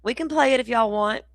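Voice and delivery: high, lightly put-on voice of a chatter pleading; mock chat voice. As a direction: playfully voice a viewer begging to play it. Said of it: "Butterfly says".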